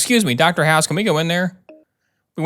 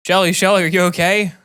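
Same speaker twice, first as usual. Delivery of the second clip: raised, urgent call to a hurt friend; worried calling out; 5 semitones higher, 3 dB louder than usual. Acted. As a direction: call her name loudly, alarmed. Calling out to crash victim.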